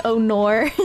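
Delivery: "oh nor" with "nor" said like Kiwi "no", with a laugh. Shown as a demonstration. Streamer showing her favourite Kiwi vowel, giggling. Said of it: "I love saying".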